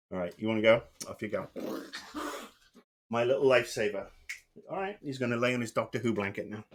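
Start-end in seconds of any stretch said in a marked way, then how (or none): none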